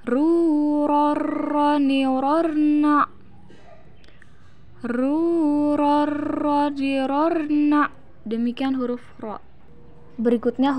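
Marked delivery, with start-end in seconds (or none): 0.0-3.1 long, drawn-out chant with rolled r; slow recitation drill; swung syllables. Shown as a demonstration. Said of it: "ayunkan lagi suaranya". 4.8-7.9 long, drawn-out chant with rolled r; slow recitation drill; swung syllables. Shown as a demonstration. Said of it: "ayunkan lagi suaranya".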